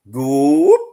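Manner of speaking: high, loud whoop shouted into the woods; loud whoop call. Retold or imitated. Hunter calling Bigfoot from the woods, a high loud whoop. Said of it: "I did a real loud".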